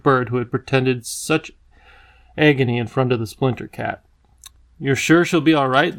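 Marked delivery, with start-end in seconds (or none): none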